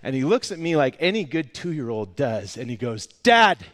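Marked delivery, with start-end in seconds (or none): 3.1-3.6 high, loud mock toddler yell; imitated excited little-kid shout; toddler calling out. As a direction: yell for Dad like an imitated two-year-old. Toddler calls out to father.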